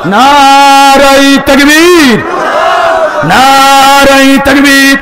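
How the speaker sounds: long, drawn-out slogan call; rallying cry. Real, not acted. Preacher calling crowd to answer the takbir, rallying.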